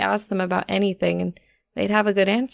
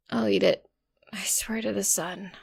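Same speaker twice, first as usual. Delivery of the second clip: grumbled; 7 dB quieter than usual. A narrator's reading. Rose grumbling on the ground, soft and sore. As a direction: groan it out half-awake. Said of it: "I grumbled".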